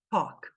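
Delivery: "talk" with one open O, no L. Shown as a demonstration. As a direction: say "talk" as "tók", the L silent. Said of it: "vão ter o som de O".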